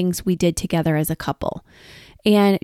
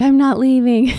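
higher, on the edge of laughter; retold playful refusal, breaking into a laugh; amused retelling; 6 semitones higher, 4 dB louder than usual. Retold delighted refusal, laughing after.

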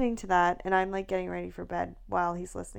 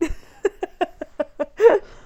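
loud laugh; 9 semitones higher, 11 dB louder than usual. Real, not acted. Laughing at own story.

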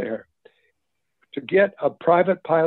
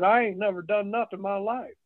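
put-on Southern accent; 6 semitones higher than usual. Young soldier answering the casting director, lightly Southern. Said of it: "I had one of them Southern accents".

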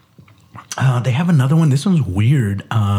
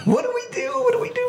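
mock panic; 4 dB quieter, much faster than usual. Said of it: "it's part of that alarm thing".

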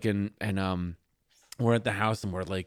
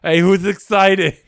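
booming slow hype; loud slow cheer; 10 semitones higher, 14 dB louder than usual. Pretend.

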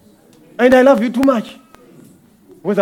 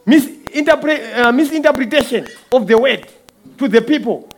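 fiery preaching; 3 semitones higher, 4 dB louder than usual. Acted. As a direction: preach it like calling witnesses to the stand. Preaching at full force.

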